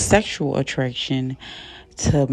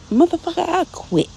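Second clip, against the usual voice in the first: indignant outburst; 13 semitones higher than usual. Retold or imitated. Big L fired, quits angrily.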